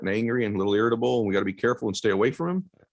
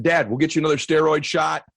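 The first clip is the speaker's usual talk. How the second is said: much higher, louder, urgent; desperate plea; 9 semitones higher, 6 dB louder than usual. Retold or imitated. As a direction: push back hard, begging. Son pleading with father.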